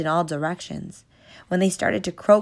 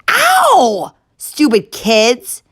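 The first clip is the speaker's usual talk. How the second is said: high loud yelp, then slow angry shout; pained yell; 9 semitones higher, 12 dB louder, much slower than usual. Acted. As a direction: cry out from the kick. Guard kicked in the shin.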